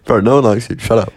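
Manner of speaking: casual, throwaway insult; offhand put-down at an everyday level; dismissive. Shown as a demonstration. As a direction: brush them off in passing. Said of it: "just dismissively".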